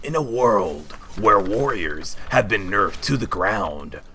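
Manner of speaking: slow, dramatic trailer announcer; movie trailer voice. Shown as a demonstration. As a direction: sell it like an epic blockbuster trailer. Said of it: "I can do the movie guy voice".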